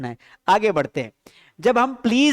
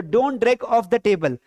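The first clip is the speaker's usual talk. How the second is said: very slow, word by word, for the class; slow model sentence; much slower than usual. Shown as a demonstration. Teacher dictating an English sentence to students, very slowly. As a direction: spell each English word out slowly for learners. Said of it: "तो".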